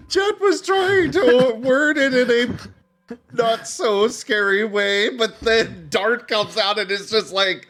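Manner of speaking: talking through big laughter; loud, slow, laughing it out. Real, not acted.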